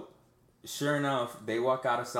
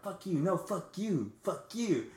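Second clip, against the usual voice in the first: retold shouting, pitched up but held in volume; mock screaming match; 8 semitones higher, slower than usual. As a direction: act out two people yelling back and forth. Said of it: "me yelling at this fucking hooker and her, like, screaming back".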